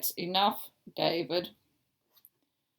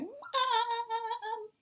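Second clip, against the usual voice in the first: a hint of a child calling Mum, higher; politely calling for mum; small child calling; 13 semitones higher than usual. Teacher imagining David at the door, calling Mum. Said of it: "stood in the doorway going".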